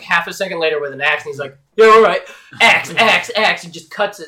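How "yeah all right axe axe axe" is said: loud, punchy hero shout; hammed-up action-hero bursts; acted axe attack. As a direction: punch each word like a chop. Acting Ash returning with an axe.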